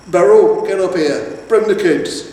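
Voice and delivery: loud call up the bank. A narrator's reading. Troll calling his wife from the bridge, loud. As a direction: shout down to the wife below.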